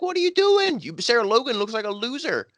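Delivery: high-pitched exasperated challenge. Acted. Fan calling out a wrestler's showboating, exasperated. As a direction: pitch it way up, indignant and incredulous.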